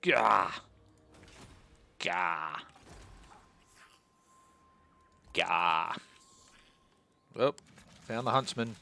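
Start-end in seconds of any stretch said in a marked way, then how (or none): 0.0-5.9 loud, sharp grunt of annoyance; frustrated gah. Real, not acted. Player annoyed at his campaign, loud frustrated grunts. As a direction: spit out a fed-up gah.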